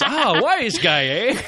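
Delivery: high, loud, slow Scottish constable; laughing Scottish policeman accent. Retold or imitated. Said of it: "Scottish constable".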